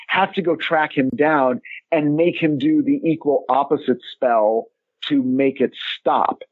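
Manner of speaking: ordinary talk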